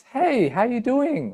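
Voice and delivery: high, drawn-out friendly hello; friendly greeting. Shown as a demonstration. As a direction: greet them like a happy friend. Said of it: "The pitch kind of goes up".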